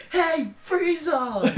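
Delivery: high, drawn-out mock hero shout; imitated trailer call. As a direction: call out to a villain, slow and high. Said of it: "at the end, he's like".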